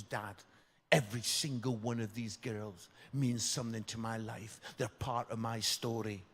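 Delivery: low, hushed sincerity; earnest plea. Retold or imitated. Daughter persuading dad about her bridesmaids, earnest. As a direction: soften right down, as if deeply meant.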